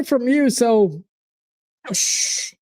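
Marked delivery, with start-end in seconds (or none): 1.9-2.5 breathy shh to a rival; shushing. Real, not acted. Fan shushing the Chelsea supporter in chat, unvoiced. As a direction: hush him mockingly.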